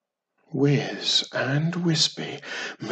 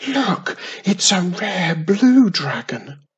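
excited; 6 semitones higher, 6 dB louder than usual. A narrator's reading. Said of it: "in excitement".

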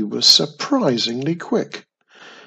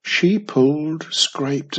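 ordinary delivery, nothing marked

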